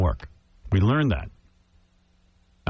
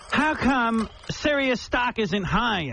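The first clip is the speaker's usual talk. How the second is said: imitating the woman; 9 semitones higher, much slower than usual. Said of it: "She goes".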